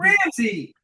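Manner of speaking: put-on calls the name high and loud, like an old friend; emphatic greeting. Shown as a demonstration. Sales coach demoing an emphatic greeting, high and loud. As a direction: greet him like you know him. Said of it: "talk to them like you know them".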